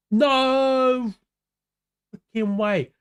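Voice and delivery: can't believe what a viewer just wrote; astonished shout. Real, not acted. A streamer shouts in disbelief at a viewer's news.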